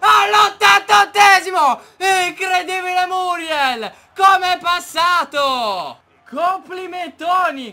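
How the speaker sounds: loud, thrilled shouting of the goal; excited goal shout. Real, not acted. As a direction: shout it out in disbelief. Excited commentary after the goal.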